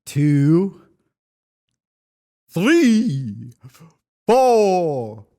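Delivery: counting like the Sesame Street vampire; transylvanian accent. Retold or imitated. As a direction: Count von Count voice, slow counting. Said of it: "do the count voice from Sesame Street".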